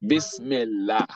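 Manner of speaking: Bismillah slowly, ending in a thin "lah"; light Lam. Shown as a demonstration. Teacher giving the Kesra example, light Lam. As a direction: say Bismillah as "bis-mil-LAH", not "law".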